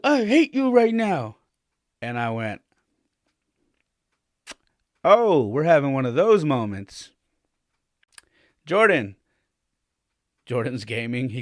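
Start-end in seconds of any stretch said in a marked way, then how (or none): 0.0-1.4 imitated angry child, high and loud; upset kid outburst. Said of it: "very upset, and said". 5.0-7.1 retold dad's loud, raised reaction; loud, higher-pitched "now you've done it" from a father; stern dad warning. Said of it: "he heard it in my voice". 8.6-9.1 calling a son sharply, loud and higher; retold fatherly summons with force; stern dad calling. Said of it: "he heard it in my voice".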